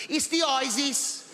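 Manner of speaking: a hint of a Birmingham neighbor saying "isis"; Birmingham accent. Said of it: "That's how she spells it".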